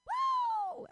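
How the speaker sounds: very high wailing whoa; hammed-up high whoop; wild whoop. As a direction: let out a crazed howl. Demonstrates menopausal craziness.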